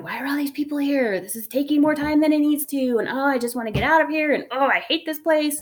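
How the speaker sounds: mock-grumbling shopper; annoyed. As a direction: vent at the crowded store, exasperated. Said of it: "I was annoyed".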